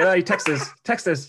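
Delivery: "Texas" for "texts us", loud and high; amused demo of slangy "Texas" for "texts us"; fast Geordie slang. Shown as a demonstration. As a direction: run "texts us" together into "Texas". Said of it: "I'm saying it so fast".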